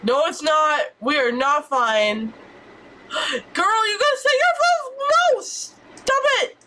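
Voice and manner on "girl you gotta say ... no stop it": high, squealing plea. Acted. Gamer begging her sim in a high squeal.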